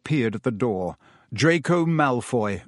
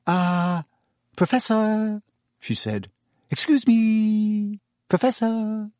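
put-on higher, slow timid girl; cautious; 9 semitones higher, slower than usual. A narrator's reading. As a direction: wake him cautiously. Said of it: "approached Professor Lupin cautiously".

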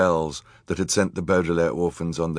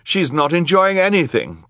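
high, loud, urgent protest; boy's cry; 11 semitones higher, 5 dB louder than usual. A narrator's reading. Klaus contradicting the villain before the crowd, high loud cry. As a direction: cry out against the lie. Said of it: "Klaus cried".

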